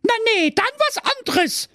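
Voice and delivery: very high fairy voice, backtracking; hasty fairy. Acted. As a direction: hurriedly take it back as the fairy. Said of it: "sprach die Fee eilig". (eilige Fee, sehr hoch)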